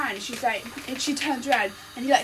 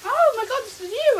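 high, louder startled recognition; mock surprise; 10 semitones higher, 6 dB louder than usual. Lando realising at her door, hammed-up surprise. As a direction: gasp it out as if it just clicked.

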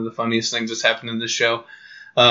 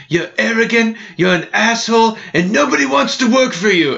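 higher, ranting chef impression; Gordon Ramsay; 9 semitones higher than usual. Host tears into a restaurant owner, ranting chef voice. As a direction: scold the owner like a furious TV chef. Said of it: "Gordon Ramsay's like".

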